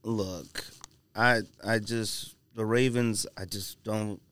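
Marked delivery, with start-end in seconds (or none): none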